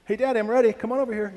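put-on high, loud little boy calling his dad; child voice. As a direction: call out like an eager kid ready to ride. Preacher voices his little son.

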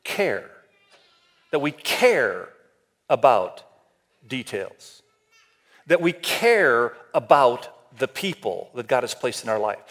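slow down and press "care" as the key word; stress on care. Shown as a demonstration. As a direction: lean hard on "care" each time it comes, said low and slow with pauses. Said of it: "The emphasis is on the word".

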